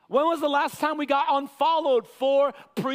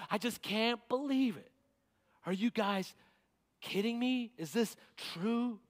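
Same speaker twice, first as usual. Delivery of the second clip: much softer, stunned disbelief; quiet astonishment; 8 dB quieter than usual. Acted. Paul quietly stunned by the news, hushed disbelief. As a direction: murmur it, stunned. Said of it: "He's astonished".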